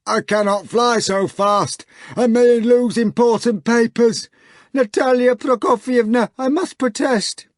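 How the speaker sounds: fussy hog official. Acted. The hog protesting to Natasha, high and loud. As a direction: protest with prim dignity.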